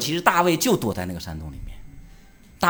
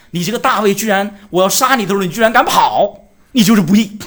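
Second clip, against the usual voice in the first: louder, rising indignant accusation, slowing at 居然敢跑; Saul's anger; 4 semitones higher, 7 dB louder than usual. Acted. Saul scolding David for running away, building louder anger. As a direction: play the king outraged that David fled.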